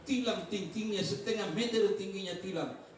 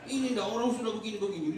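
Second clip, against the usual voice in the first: ordinary talk, nothing marked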